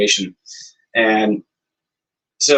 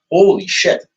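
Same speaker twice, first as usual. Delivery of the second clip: higher, louder burst of amazement; stunned reaction; 8 semitones higher, 5 dB louder, slower than usual. Retold or imitated. Musician hearing of a big opportunity, amazed. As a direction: retell being floored by the news. Retold reaction to surprising news.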